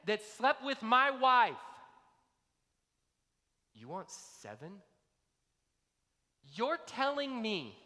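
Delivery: loud, slow, high outraged protest; indignant outrage. Retold or imitated. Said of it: "That would have been the tone".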